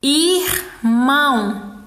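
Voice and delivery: IRMÃO in two slow beats, ir, then mão; split into syllables. Shown as a demonstration. Teacher counting two syllables, irmão split slowly. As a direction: pause between ir and mão in irmão.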